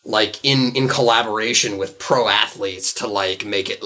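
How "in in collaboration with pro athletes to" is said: ordinary delivery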